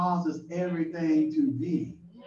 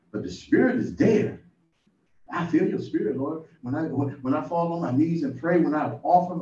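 usual speaking voice

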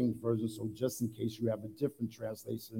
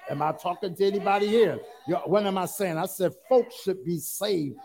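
loud, raised-pitch sermon delivery; preaching at full force; 5 semitones higher, 7 dB louder than usual. Real, not acted. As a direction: drive each phrase home to the congregation.

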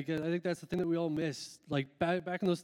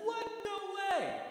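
surprised disbelief; 3 dB quieter than usual. Retold or imitated. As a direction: play a stunned old classmate who can't believe it. Old schoolmates hear surprising news.

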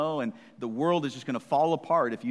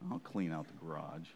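sulky mutter; 8 semitones lower, 12 dB quieter than usual. Retold or imitated. Kid muttering grudgingly.

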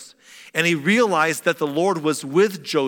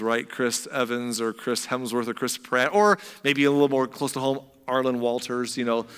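usual speaking voice

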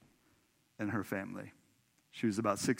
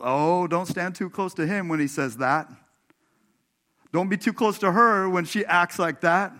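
higher, louder mock warning; joking friend; 7 semitones higher, 8 dB louder than usual. Friend warning people away from a sinner, higher and louder mock. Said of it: "My friend always jokes about".

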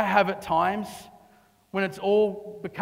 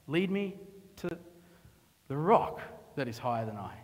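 hushed quote; 4 semitones lower, 6 dB quieter than usual. Acted. Preacher returning to the psalm line, soft and low. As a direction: bring it back down, almost reverent.

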